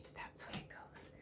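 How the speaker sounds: whispered aside; a rushed whispered shrug. Shown as a demonstration. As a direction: whisper it fast under your breath. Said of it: "Sorry I'm a bit rushed today".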